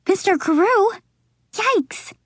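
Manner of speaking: high alarmed gasp of a name; sudden high panic at the name; shocked. A narrator's reading. Katie realizing she is her dad, high and alarmed.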